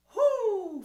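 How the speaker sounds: sharp high loud hoo from the belly; breath pulse from the belly. Shown as a demonstration. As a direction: pump a quick hoo from the belly. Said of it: "I want you to go".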